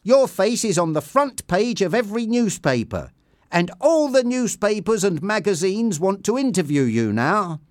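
upbeat manager sharing a triumph; happy. A narrator's reading. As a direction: gush over the good news. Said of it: "he said happily".

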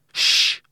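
sharp, drawn shush; hushing. A narrator's reading. Leo quieting his shouting friend, hushing. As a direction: hush a noisy friend.

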